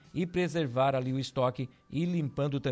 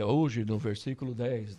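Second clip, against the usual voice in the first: lower, solemn Bible reading voice; Cid Moreira voice; 3 semitones lower than usual. Pretend. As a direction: read it like a grave Bible narrator. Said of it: "igual o Cid Moreira".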